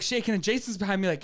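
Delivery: ordinary talk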